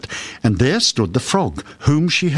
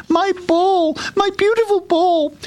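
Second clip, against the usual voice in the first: high-pitched delighted princess cry; overjoyed. A narrator's reading. Princess reunited with her golden ball, overjoyed. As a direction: exclaim in high storybook joy.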